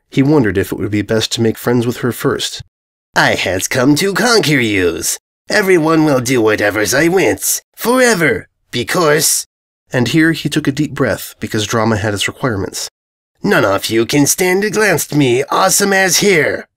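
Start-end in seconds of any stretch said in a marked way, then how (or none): 3.1-9.5 slow, whiny wannabe overlord; voiced by the invader, slow, grandiose and whiny; pompous villain voice. Invader's dramatic declaration voiced. 13.4-16.7 slow, whiny wannabe overlord; voiced by the invader, slow, grandiose and whiny; pompous villain voice. Invader continues his declaration.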